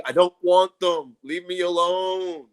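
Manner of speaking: drawn-out, loud refusal; slow firm refusal. Retold or imitated. Refusing the flower seller's gift, slow and firm. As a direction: refuse each word slowly. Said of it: "a big brawl started".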